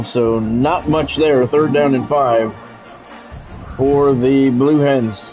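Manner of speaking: normal talking voice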